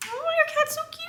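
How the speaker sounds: high squeaky aww at a cute cat; cooing over a cat. Real, not acted.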